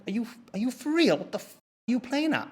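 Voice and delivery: high, squeezed disbelief without shouting; incredulous. Retold or imitated. Guest reacting to being hung up on, incredulous. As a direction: react high-pitched, as if baffled.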